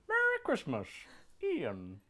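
hammed-up Southern drawl, first words pitched high. Reading the gift tag aloud to friends, Southern drawl. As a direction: read it out in a playful Southern twang. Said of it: "It is a Southern day here".

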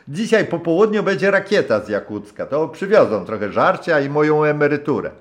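put-on high, loud hearty old sailor's voice; a rough old skipper warming to his guest; gruff old captain. (serdeczny, szorstki głos starego kapitana)

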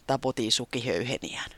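ordinary delivery

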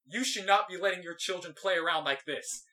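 emphatic mock reprimand, half a yell; retold telling-off. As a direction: imitate an old man reprimanding a parent. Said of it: "after yelling at me".

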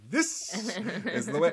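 soaring awestruck high pitch; reverent high voice. Retold or imitated. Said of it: "I'm like".

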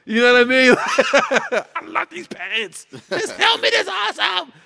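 high, loud gushing with laughter; excited. Pretend. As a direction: gush like a kid in a store. Acting out eager buying spree.

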